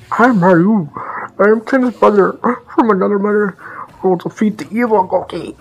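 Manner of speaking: high, loud mocking hero voice; mocking Ryu. Jumping up and like.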